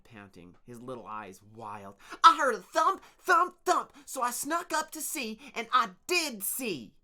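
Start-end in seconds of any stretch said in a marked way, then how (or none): 2.2-6.9 very high, loud and excited; breathless and shrill. Acted. Torin panting his story to the workers, shrill. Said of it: "his shrill, high voice".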